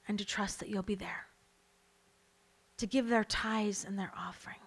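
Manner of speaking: soft unvoiced prayer whisper. Real, not acted. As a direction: pray it under the breath.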